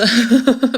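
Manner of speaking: sudden loud giggle at own slip; burst of laughter. Real, not acted. Reader amused at stumbling over a card word, laughing loudly. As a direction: laugh at yourself for fumbling the word.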